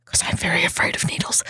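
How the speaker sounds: soft, whispered admission; hushed confession. Real, not acted. Patient confessing a fear of needles to friends, hushed. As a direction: drop to a near whisper to own up.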